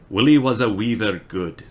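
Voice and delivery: badly faked Scottish; attempted Scottish accent. Shown as a demonstration. Streamer struggling through Burns poem for chat, attempted Scottish accent. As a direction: have a shaky-confidence go at Scottish. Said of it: "I can't do it right now".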